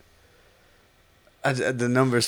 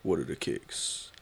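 whisper; 5 semitones lower, 12 dB quieter than usual. Acted. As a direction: hush it, teasing. Hushed teasing.

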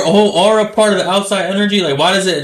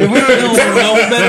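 put-on old prospector; 4 semitones higher than usual. Old-timer scolding the young guests.

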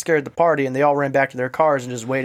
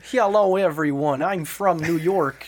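put-on New York accent; 3 semitones higher than usual. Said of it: "give him a New York accent".

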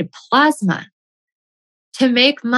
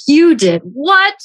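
a raised, slow, disbelieving "You did what?"; mock angry husband; 6 semitones higher, 4 dB louder, slower than usual. Husband hearing about the course, angry and stunned. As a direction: play the stunned husband, higher and louder. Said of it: "He was so mad".

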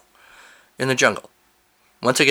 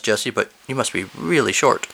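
ordinary delivery

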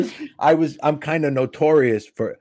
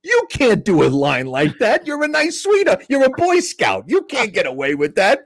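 high-pitched exasperated telling-off, re-enacted; incredulous scolding; 8 semitones higher than usual. Comic telling Keith he can't use that line, incredulous scolding. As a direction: scold a friend in mock disbelief, pitched up.

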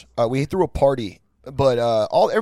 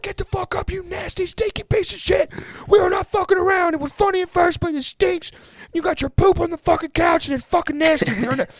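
much higher, strained squeaky yell; mock yelling. Roommates yelling at friend who soiled couch, high squeaky mock yell. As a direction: shriek at him like an outraged roommate. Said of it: "we were all yelling".

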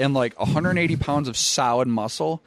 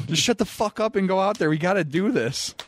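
quick, high-pitched urging; retold exasperation; 9 semitones higher than usual. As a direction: order a stalling teammate out, retelling it. Retold quarrel with teammate before match.

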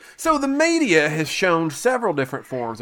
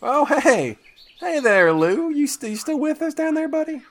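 mock friendly; 14 semitones higher than usual. Calling down to wounded werewolf.

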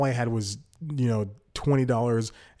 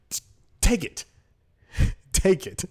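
higher, fed-up push; just take it already, pitched up; exasperated insistence; 8 semitones higher than usual. Retold or imitated. Giving food to picky homeless person, exasperated insistence.

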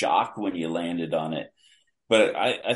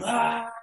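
high mock yell at usual volume; imitated yelling. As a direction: imitate a wrestler's long yell, high-pitched. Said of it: "He's yelling the whole time".

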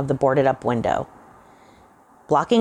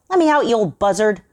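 higher, louder furious yell; angry shout; 8 semitones higher, 4 dB louder than usual. A narrator's reading. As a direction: curse him through the door. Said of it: "shouted Johnson".

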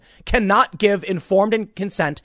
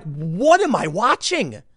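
slow, drawn-out stunned question; disbelief; slower than usual. Retold or imitated. Reacting to the film scene, slow stunned disbelief. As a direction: let the disbelief stretch out. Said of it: "I'm like".

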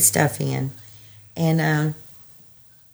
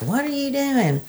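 imitating the husband; 8 semitones higher, 6 dB quieter than usual. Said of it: "And he's like".

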